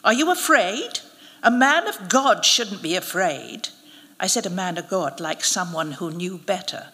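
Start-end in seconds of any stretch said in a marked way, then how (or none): 0.0-3.7 goading, pitched up on the question; mocking challenge; taunting. A narrator's reading. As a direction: needle him, daring him to admit it.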